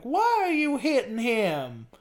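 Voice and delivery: put-on high, slow baffled old man; confused Southern old man. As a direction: ask it like a clueless old Southern manager. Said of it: "actual Southern old man as a manager".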